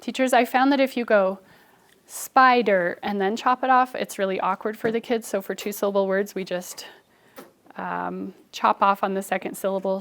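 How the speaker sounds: usual speaking voice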